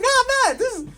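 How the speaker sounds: squeaky panicked pleading. Retold or imitated. Comic pleads with girlfriend who doubts Rudolph, shrill panic. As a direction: plead like nobody believes you. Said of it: "And I was like".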